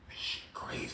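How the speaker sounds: whispered under the breath, no voice. Retold or imitated. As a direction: whisper it so nobody hears. Watching in secret with popcorn.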